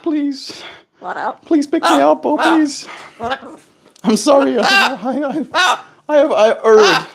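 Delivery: high, pleading little bird voice. Retold or imitated. Owner voicing the bird begging to be picked up, high pleading voice. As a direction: beg like a tiny bird, half laughing.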